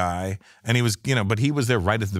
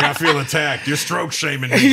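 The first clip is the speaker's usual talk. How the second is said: mock offended friend; 6 semitones higher, 5 dB louder than usual.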